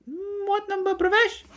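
very high squeaky questioning; high-pitched, obtuse caller; prank caller. Acted. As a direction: keep up the silly high prank voice. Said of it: "I'd go".

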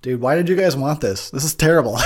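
normal talking voice

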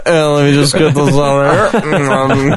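high, very slow prissy imitation; slow mock coworker. Mimicking the coworker eating Cheetos with chopsticks, slow and high. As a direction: drag it out like a fussy coworker.

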